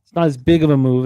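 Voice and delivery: normal talking voice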